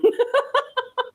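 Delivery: high burst of disbelieving laughter; incredulous laugh. Real, not acted. Commenting on a clip of a customer squaring up, laughs in disbelief.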